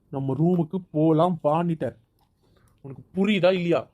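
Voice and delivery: loud, forceful repeated order; angry insistence. Acted. Husband repeats his order, losing patience.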